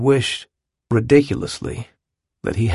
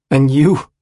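angry; 3 semitones higher, 5 dB louder than usual. Acted. JB lashes out at Jude.

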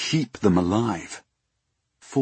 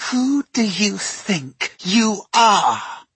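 put-on high, slow, furious girl's hiss; seething through clenched teeth; 12 semitones higher, 3 dB louder, much slower than usual. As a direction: spit it through gritted teeth. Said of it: "she seethed through clenched teeth".

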